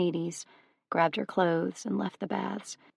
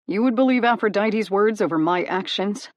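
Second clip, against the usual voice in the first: louder pleading call after her; 2 semitones higher, 7 dB louder than usual. Acted. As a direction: call out to her as she turns away. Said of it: "he called out".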